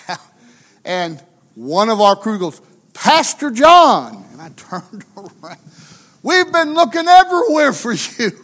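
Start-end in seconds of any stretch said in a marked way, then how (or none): 2.9-4.3 loud, drawn-out imitated call; higher, slow retold holler. Crew member calling to lost pastor. 6.2-8.3 high, loud imitated holler; pitched-up retold shout; exasperated calling out. Crew member calling to lost pastor.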